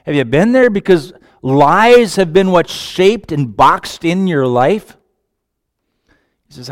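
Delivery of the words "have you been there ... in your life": ordinary talk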